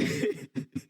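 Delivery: a quick high airy laugh; high breathy laugh. Real, not acted. Pastor laughing at his own school boast, high and airy. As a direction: laugh at yourself.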